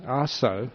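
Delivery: "Ah, so." with no L sound at the end, soft. Shown as a demonstration. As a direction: say "Ah, so." cleanly, ending on the open o. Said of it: "don't put the L on the end".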